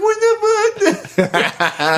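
high whimpering outrage, half laughing; mock tearful protest. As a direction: imitate yourself wailing after a slap. Retold reaction to being hit.

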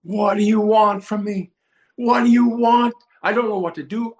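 high, loud cry to God; screaming. Retold or imitated. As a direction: yell it out in desperate frustration. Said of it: "and I start screaming".

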